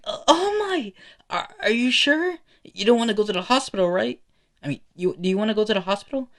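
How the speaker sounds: high, loud, rushed questions; panicked concern. Acted. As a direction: fret urgently over a hurt hero. Kid panicking at hero coughing blood.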